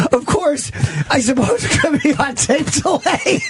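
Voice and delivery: high, giggly read of the quote; laughing punchline. Retold or imitated. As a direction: deliver the punchline while cracking up.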